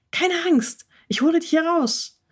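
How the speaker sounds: reassuring promise. Acted. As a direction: promise to get her out, gently. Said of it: "versprach Frieda". (tröstendes Versprechen, höhere Stimme)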